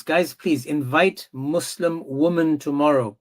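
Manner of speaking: normal talking voice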